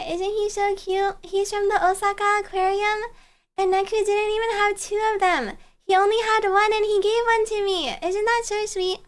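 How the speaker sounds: ordinary talk, nothing marked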